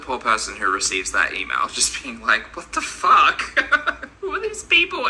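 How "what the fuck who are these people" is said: high-pitched, laughing mock disbelief; squeaking upward through laughter; laughing incredulity. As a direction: act out the baffled reader while cracking up. Laughing, acts out baffled email recipient.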